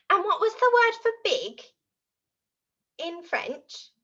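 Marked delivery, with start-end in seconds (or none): none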